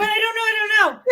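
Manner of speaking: anxious fan. Retold or imitated. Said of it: "And I'm like".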